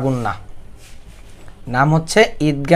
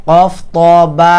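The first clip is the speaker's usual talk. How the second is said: ordinary delivery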